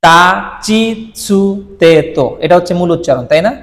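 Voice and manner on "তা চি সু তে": তা, চি, সু, তে spoken very slowly, each syllable apart; slow syllable drill. Shown as a demonstration. Teacher to students, slowly listing তা চি সু তে. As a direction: drill the kana sounds one by one, very slowly. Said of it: "যেমন".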